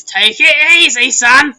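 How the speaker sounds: loud, slow gruff gnome voice; gnome's hearty warning. Acted. Gnome warns the half-elf at the soup table, booming and slow. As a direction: boom it like a big hulking gnome. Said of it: "looks up at you ... and goes".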